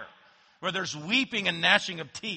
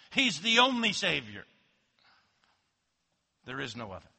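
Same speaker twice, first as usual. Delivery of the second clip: ordinary talk, nothing marked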